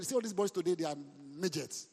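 ordinary talk, nothing marked

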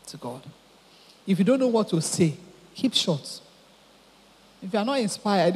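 ordinary talk

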